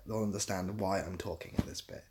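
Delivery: normal talking voice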